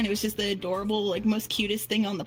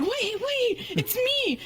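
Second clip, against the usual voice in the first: much higher, hurried mock pleading; panicked clown; 11 semitones higher than usual. Clown caught at the door by the mom, higher panicked pleading. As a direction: squeak it out like a caught prankster. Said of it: "And the guy's like".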